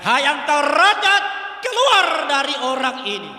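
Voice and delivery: loud, forceful command to the spirit; preacher voicing Jesus casting out the demon, loud; commanding rebuke. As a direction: order the demon out with full authority. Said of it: "dan dia katakan".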